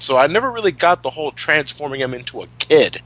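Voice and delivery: ordinary talk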